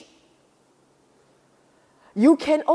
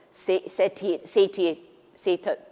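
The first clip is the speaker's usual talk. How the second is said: ordinary delivery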